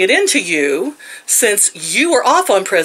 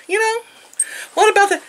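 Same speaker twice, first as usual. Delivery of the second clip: high mock voice; 12 semitones higher, faster than usual. Mocking own question to clerk.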